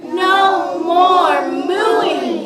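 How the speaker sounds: high, loud, drawn-out holler; storybook shout. Acted. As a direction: shout each word long and slow for the children. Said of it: "shouted Mister Brown".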